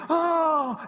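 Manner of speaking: high, loud startled "oh"; imitated shock. Newcomer sits down and sees who is next to him, loud startled cry.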